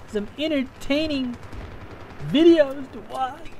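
high, wailing fake sob; hammed-up blubbering breakdown; mock crying. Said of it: "No more crying".

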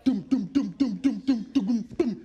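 mouthed beat; beatbox the drum; beatbox toum. Acted. Vocal beat.

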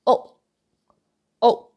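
"oh" very short, throat closing on an unreleased K; stopped K. Shown as a demonstration. Teacher repeats the right way, "oh" with stopped ending. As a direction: cut "oh" off sharply, no puff. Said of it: "No K sound".

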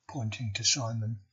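ordinary delivery, nothing marked

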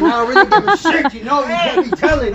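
laughing yell. Real, not acted. Rapper hyped at the end of his freestyle, laughing yell. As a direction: shout it while laughing. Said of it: "why am I yelling?".

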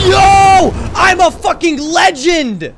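loud, slow victory yell, drawn out; triumphant shout. Real, not acted. Gamer yelling in triumph after his helicopter massacre. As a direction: bellow like you just won it all.

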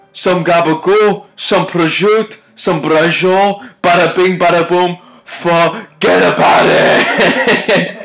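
high, hammed-up New Jersey Italian accent; mock Italian-American. All-caps Italian stereotype comment.

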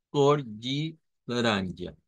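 "Cor de laranja" slow and careful, ko-ji la-RAN-ja; Portuguese pronunciation. Shown as a demonstration. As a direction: repeat slowly for students. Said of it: "Recuerden esa A nasalizada". ("Cor de laranja" lento y claro)

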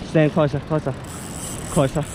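ordinary delivery

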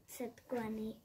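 ordinary delivery

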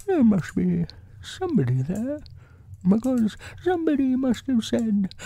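high bear voice, thinking aloud; Pooh. A narrator's reading. Bear thinking to himself by the hole, high musing voice. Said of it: "he thought to himself".

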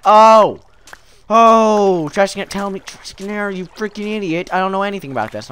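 hammed-up fed-up outburst; acted exasperation. Voicing Bob's annoyed outburst.

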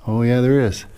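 normal talking voice

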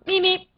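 short high beeps; beep imitation. Gamer doing random sound effects, high beeps. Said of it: "do some random sound effects".

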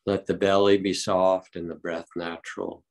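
unhurried calm meditation guide; guided meditation. Acted. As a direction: ease the group gently into stillness.

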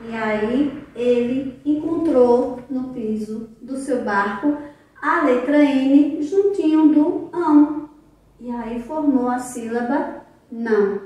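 plain reading voice

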